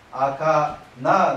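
赤な as "akaa, naa"; 赤な with i dropped, long aa; long-a dialect forms. Shown as a demonstration. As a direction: lengthen each final a in 赤な. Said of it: "などという発音が聞かれます".

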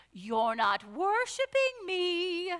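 high-pitched, slow singsong; drawn-out, high musical complaint; singsong actress impression. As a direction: mimic a movie actress, high and singy-songy. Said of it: "high-pitched, singy, songy voice that this actress did".

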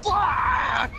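high angry yell; frustrated shout. Real, not acted. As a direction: shout it as you get caught. Caught by the killer.

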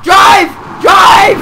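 loud, high yelled command; urgent shout. Real, not acted. Player yelling at teammate to escape an enemy, urgent shout. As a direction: shout each word as an urgent order. Urging teammate to drive away.

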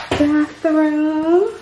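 normal talking voice

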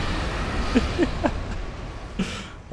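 high breathy cackle; evil cackle. Real, not acted. Laughing at the trapped opponent, high evil cackle. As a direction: cackle wickedly.

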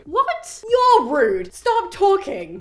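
indignant retort. Real, not acted. Narrator retorts at the suitors.

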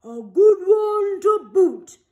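loud slow crew chorus; a rowdy crew agreeing; pirate crew. A narrator's reading. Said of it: "the others agreed".

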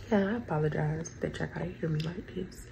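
ordinary delivery

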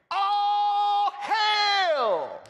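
high, long-held shouted proclamation; big ringing cry to a crowd; drawn-out shout. Acted. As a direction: holler it out, stretching each word. Booming shouted scripture quote to congregation.

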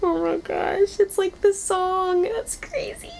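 high, giddy squealing; excited squeal. Real, not acted. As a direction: gush like you can't believe it. Delighted by the robot's fox laugh.

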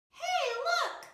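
high singsong mocking call; high taunting voice. A narrator's reading. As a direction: tease like other seeds pointing. Other seeds taunt again.

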